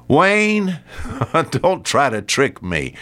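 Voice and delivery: high, drawn-out name, then back down; mother scolding. Retold or imitated. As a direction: imitate mom calling out a suspected trick. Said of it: "and she just says".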